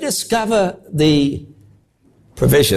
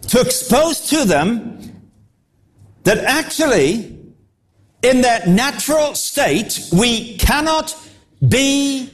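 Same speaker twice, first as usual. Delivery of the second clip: slow, higher, word by word; slow emphasis; 6 semitones higher, slower than usual. Acted. Preacher stressing the point to the congregation, slow. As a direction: lean on each phrase slowly. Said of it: "preacher slows for emphasis".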